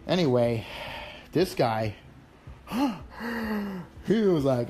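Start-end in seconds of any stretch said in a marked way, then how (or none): 2.5-4.0 sharp high gasp, caught off guard; startled gasp. Retold or imitated. Retelling a collector's call, a startled high gasp. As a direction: let out a quick gasp of shock.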